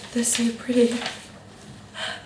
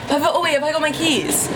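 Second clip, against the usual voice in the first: laughing; 9 semitones higher than usual. Real, not acted. Laughing at forgotten keys.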